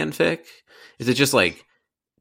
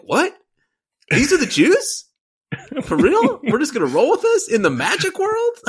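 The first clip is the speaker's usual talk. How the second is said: incredulous. Retold or imitated. Retold baffled reaction at movie.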